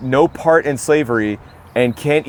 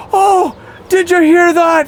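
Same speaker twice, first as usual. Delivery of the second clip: shrill-high, loud mock Irish villagers; excited Irish crowd; 6 dB louder, slower than usual. Pub locals mocking an American's Irish claim, excited high mock-Irish voice. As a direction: whoop it up slowly in a very high voice. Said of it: "all the Irish people go".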